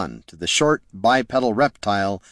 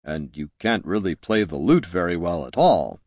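dry deadpan voice; 5 semitones lower than usual. Acted. Jin dry remark.

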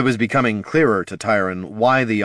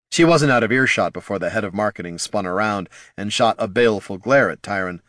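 ordinary delivery, nothing marked

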